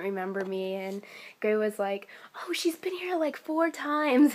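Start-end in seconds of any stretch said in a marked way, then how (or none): 2.5-4.3 high-pitched retold quote, easing down; imitated castmate explaining. Castmate telling confused colleague about the fan, high imitated voice. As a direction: lift the voice high as if vouching for someone.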